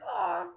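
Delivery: high mock aww of delight; touched aww. Girlfriend touched by the rose and collage, high aww. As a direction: coo like she is melting.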